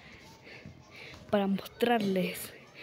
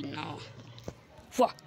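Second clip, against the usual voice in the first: usual speaking voice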